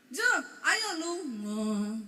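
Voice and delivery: "Jo, ayo lungo" very slow, dropping at the end; exaggerated slow Javanese-style "Jo, ayo lungo". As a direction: draw out "lungo", letting the pitch sink. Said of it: "nadanya belakang turun".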